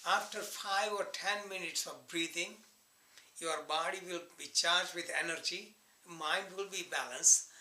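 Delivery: ordinary talk, nothing marked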